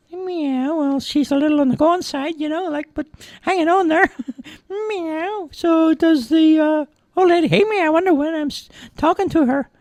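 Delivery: very high cartoon cat voice; talking cat Fluffy. A narrator's reading.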